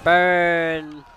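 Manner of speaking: loud, drawn-out shout; gleeful battle cry. Pretend. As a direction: yell it long as you attack. Attacks enemies with fire.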